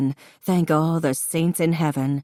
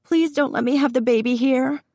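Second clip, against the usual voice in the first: very high, frightened begging; high and scared; 8 semitones higher than usual. A narrator's reading. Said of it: "Her voice was high and scared".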